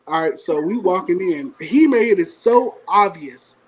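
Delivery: ordinary talk, nothing marked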